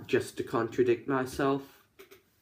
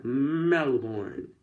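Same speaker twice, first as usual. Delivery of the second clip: mock American accent. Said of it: "I nailed the accent there".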